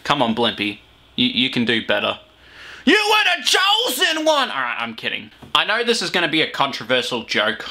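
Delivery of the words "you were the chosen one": high, drawn-out dramatic wail; hammed-up movie outburst, pitched way up; mock anguished cry. Reactor quoting a Star Wars meme at the host, mock anguish. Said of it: "Insert Star Wars meme".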